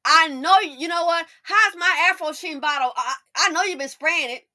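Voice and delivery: loud, high teasing accusation; mock accusation. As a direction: tease the partner loudly. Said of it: "inside joke within a couple".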